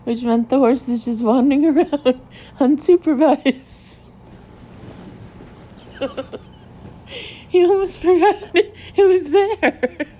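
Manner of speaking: high, loud, real laughing fit while talking; helpless laughter. Real, not acted. Teller picturing an unsupervised horse at the wedding, cracking up. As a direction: barely get the words out for laughing.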